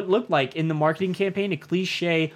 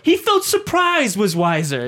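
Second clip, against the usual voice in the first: higher, louder, slow British villain impression; General Veers; 7 semitones higher, 4 dB louder, slower than usual. Fan quoting a favorite villain all day, drawn-out imitated British officer. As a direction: draw it out like a British film officer. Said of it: "I could quote that fucking dude all day".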